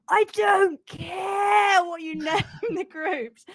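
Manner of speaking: high, slow, laughing shout. Retold or imitated. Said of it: "Brad was shouting".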